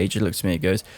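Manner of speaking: usual speaking voice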